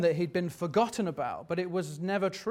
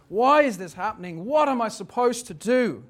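raised, higher cry of frustration; shouted anguish; 5 semitones higher, 5 dB louder than usual. Acted. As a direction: cry out to God in broken desperation. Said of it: "as he shouts into the cave".

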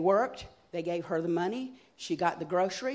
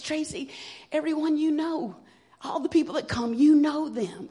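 higher, pleading mom voice; eager mother; 8 semitones higher than usual. Retold or imitated. Mother coaxing daughter to the meeting, higher and pleading. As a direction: coax her daughter, pitch up. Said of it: "She goes".

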